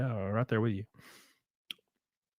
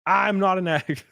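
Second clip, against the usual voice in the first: higher-pitched mock denial; addict's denial; 7 semitones higher than usual. Answering what addicts say first, mock high-pitched denial. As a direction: imitate an addict protesting innocence. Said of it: "what is the first thing addicts say?".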